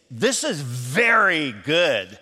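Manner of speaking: slow, drawn-out "very good" with relish; savoring praise. Shown as a demonstration. Answerer praising the cook's dish, slow and savoring. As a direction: linger on "very" like it's delicious. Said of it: "you just communicated something".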